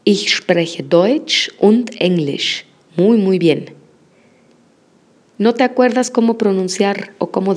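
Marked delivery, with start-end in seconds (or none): 0.0-2.6 Ich spreche Deutsch und Englisch very slowly; teacher modelling a full German sentence; slow model sentence. Shown as a demonstration. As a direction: spell out the sentence slowly for learners. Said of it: "vamos a decir".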